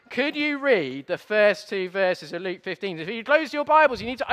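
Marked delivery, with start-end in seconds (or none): none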